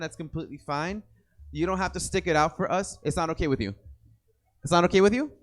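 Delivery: ordinary talk, nothing marked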